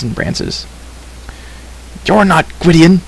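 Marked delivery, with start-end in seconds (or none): 2.0-3.0 high, accusing shout; raised, challenging voice. A narrator's reading. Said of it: "he shouted".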